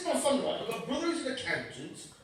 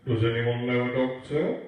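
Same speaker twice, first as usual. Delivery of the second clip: pilot announcement; 8 semitones lower, 5 dB louder than usual. Retold or imitated. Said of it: "The pilot said".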